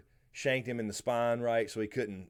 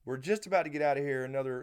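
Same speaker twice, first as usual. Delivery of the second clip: ordinary talk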